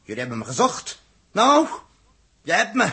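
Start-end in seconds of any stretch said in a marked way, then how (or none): 1.3-2.9 loud, bitter outburst; defiant shout. Acted. Dirk confronts the crew who accused him, shouting. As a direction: yell it at the whole crew.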